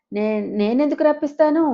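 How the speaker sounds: a caught-out aunt stammering her denial. A narrator's reading. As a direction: trip over the first word, caught out. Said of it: "అని తడబడుతూ".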